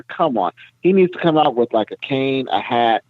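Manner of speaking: ordinary talk, nothing marked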